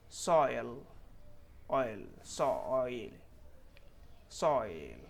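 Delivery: "सॉइल" stretched with a falling oy glide; clear "oy" sound. Shown as a demonstration. Teacher modelling oy for learners, "सॉइल" slow and clear. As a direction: draw out the oy in "सॉइल". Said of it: "यहाँ पे ओए sound clearly आ रहा है".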